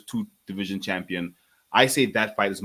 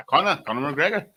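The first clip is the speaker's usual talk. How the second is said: higher, mock Irish announcer voice; Irish accent; 6 semitones higher than usual. S2 answers the request to hear the Irish accent, in mock brogue. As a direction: call his name in a hammed-up Irish lilt. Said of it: "Let me hear that Irish accent again".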